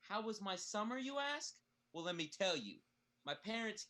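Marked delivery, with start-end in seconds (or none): 0.1-1.5 climbs high on you ask; rising question. Acted. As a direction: pitch up mockingly.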